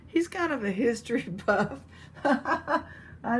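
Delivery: chuckling through the words; giggling while joking about the cat; laughing. Real, not acted. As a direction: laugh as you admit it. Laughing at own joke.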